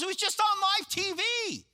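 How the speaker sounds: high, amazed insistence; he can hardly believe it himself; pastor insisting. Retold or imitated. Pastor on the phone insisting it was live, high amazed voice.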